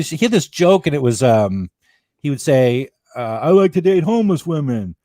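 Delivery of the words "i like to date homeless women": put-on, higher comic's delivery; imitating a comic. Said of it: "he would go up and he".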